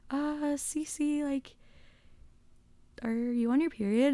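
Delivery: hesitant classmate asking gently; a hint of an awkward schoolgirl; quoting a classmate. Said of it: "she was like".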